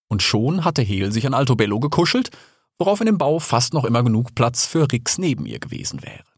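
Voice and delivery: ordinary delivery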